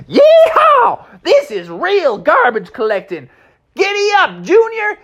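very high loud cowboy yeehaw; high whooping cowboy horse. Acted. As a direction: holler like a rodeo rider. Mustang cheering cowboy style.

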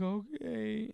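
small, high, hesitant okay; worried okay. Retold or imitated. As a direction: softly draw it out, unsure. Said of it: "she's just, like, all worried".

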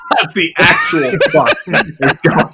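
perplexed onlooker breaking into laughter; laughing through words. Acted. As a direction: try to get the question out while cracking up. Perplexed crowd voiced through laughter.

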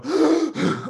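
a big, high, sudden gulp of air; imitating a kid gasping on command; sharp loud gasp. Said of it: "they go".

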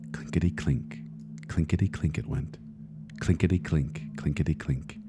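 hushed, whispered clinkety-clink; soft clinking. A narrator's reading. Narrator softly mimicking coins rattling in a tin box. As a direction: hush it like coins heard in the dark.

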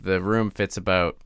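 ordinary talk, nothing marked

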